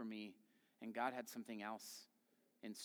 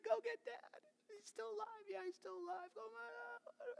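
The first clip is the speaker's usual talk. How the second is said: very high voice breaking into sobs; crying. Retold or imitated. Joseph sending his brothers for Dad, sobbing high. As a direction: weep it out, barely holding together. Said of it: "and they're crying".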